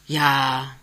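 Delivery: a low-spirited, drawn-out yes; subdued, saddened agreement; downcast. A narrator's reading. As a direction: sigh of resignation in the word. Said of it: "sagt Jachmann betrübt".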